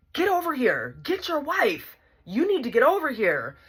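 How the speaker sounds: high-pitched, insistent ordering; urgent summons. Retold or imitated. Imitating aunts calling the husband to get his wife, urgent. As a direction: call him over, exasperated.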